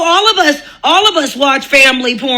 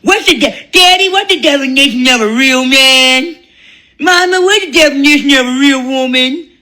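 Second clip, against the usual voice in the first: put-on kids asking; 3 semitones higher than usual. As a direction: play a small child asking innocently. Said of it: "the kids are like".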